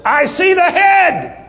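high, loud, drawn-out cry; nurse's shout. Retold or imitated. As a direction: announce it at a piercing yell. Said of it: "One of the nurses said".

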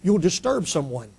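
soft, low mock caution; hushed reproof. Church folk to seekers, hushed reproof. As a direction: hush them in an undertone.

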